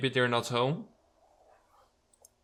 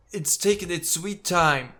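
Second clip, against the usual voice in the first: slow drawn-out delivery; 5 semitones higher, 3 dB louder, slower than usual. Shown as a demonstration. Mocking the game's slow dialogue, drawn out slow. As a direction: stretch it out lazily, slow. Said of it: "And other times".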